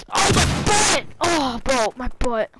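loud pained cry, pitch jumping up; loud yelp. Real, not acted. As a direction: yell out in sudden pain. Hit while fleeing, yelping.

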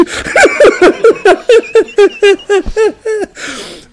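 long, loud, high laughing fit; real, helpless laughter. Real, not acted. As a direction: burst out laughing at yourself. Laughs at own confession.

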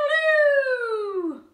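high, loud crowing; rooster crow. Acted. Fred the rooster crowing at morning light.

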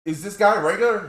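alarmed question. Retold or imitated. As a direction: ask in a panic. Freaked out, asking the manager.

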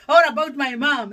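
laughing through the words; real laugh breaking up the line, then picking it up. Real, not acted. Mother recalling her son's Mother's Day writing, laughing.

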